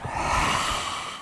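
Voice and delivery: breathy roaring exhale, tongue out; lion's breath exhale. Shown as a demonstration. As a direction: push all the air out in a hushed roar. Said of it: "and roar".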